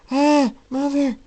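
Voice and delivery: high, loud, drawn-out scared yelp; frightened child's cry. Acted. Colt calling his mother as the storm roars, frightened.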